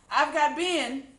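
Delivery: high, loud and slow catch; triumphant. A narrator's reading. Cindy grabbing a pig, calling out triumphantly. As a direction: call out the win. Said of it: "said Cindy".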